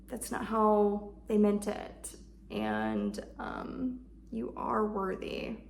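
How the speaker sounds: comforting self-talk. Shown as a demonstration. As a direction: hold her with your words. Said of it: "Just holding her and comforting her".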